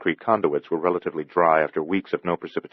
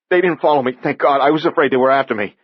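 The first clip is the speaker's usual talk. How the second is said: higher, louder, fast relieved fear; young man just escaped, higher and faster; frightened; 9 semitones higher, 7 dB louder than usual. Acted. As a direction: gasp it out, still scared. Andy fled something in tunnel.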